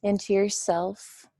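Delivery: unhurried meditation guide, long pauses between phrases; guided meditation. Real, not acted. Teacher settling listeners into a comfortable position, guided meditation.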